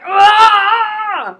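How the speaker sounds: sudden high, loud cheer of joy; cheering yell. Retold or imitated. Standing up in the theater at the heroine's line, loud cheering yell. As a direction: let out a thrilled shriek like in the theater. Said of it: "stood up in the theater like I did and went".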